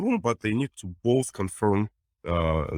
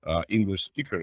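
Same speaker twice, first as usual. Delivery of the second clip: usual speaking voice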